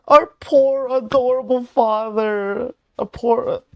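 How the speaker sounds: loud, high-pitched adoring gush; gushing excitement. Real, not acted. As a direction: gush over an adorable favourite. Gushing over returning character.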